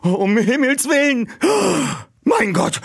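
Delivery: high, loud shocked cry; aghast at the crash; shocked exclamation. Acted. Friends reacting to Peter's crash, high aghast cry.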